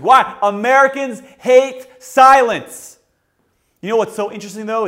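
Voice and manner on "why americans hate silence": shout. Acted. Repeats phrase loud and slow.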